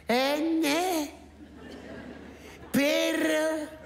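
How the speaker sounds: high, slow, halting guesses; hesitant high voice. Acted. Patient half-blind at the chart, guessing high and slow. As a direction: squeak out each guess unsure. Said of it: "Ti cambia pure la voce".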